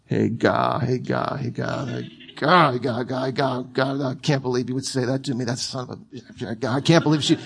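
low, soft, growly grumbling under the breath; grumbled muttering. Acted. Someone stewing over an irritating remark, low muttering. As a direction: mutter indignantly, chewing it over and over. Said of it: "You're muttering, you're churning".

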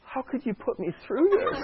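put-on high, whiny child voice through a laugh; mock-betrayed toddler, pitched high; hurt little kid. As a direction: plead like a wounded three-year-old. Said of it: "he just looked at me like".

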